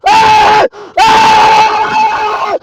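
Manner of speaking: loud, high-pitched raging screams; fight screams. Retold or imitated. Relatives kicking and screaming in a fight, raging screams. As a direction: scream at full force. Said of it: "they're kicking and screaming".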